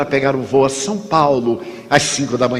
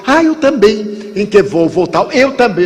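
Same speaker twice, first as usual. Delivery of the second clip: high, louder, delighted; eager stranger; 7 semitones higher, 3 dB louder than usual. Retold or imitated. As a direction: stranger thrilled to share the flight, high and eager. Stranger delighted at same flight.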